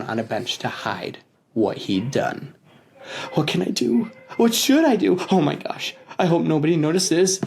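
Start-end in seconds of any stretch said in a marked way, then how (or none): 3.3-7.4 put-on worried kid voice, rising higher; panicked thoughts. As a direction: fret to yourself in a panic. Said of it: "he thought".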